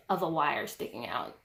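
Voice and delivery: usual speaking voice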